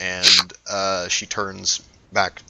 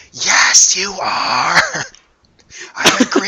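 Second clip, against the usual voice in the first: excited shout; 10 semitones higher, 8 dB louder than usual. Acted. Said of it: "you hear Eliza go".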